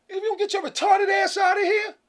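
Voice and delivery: high-pitched, exasperated shout; indignant outburst. Acted. Telling off someone in a cat suit, indignant high shout. Acted angry rejection of pretender.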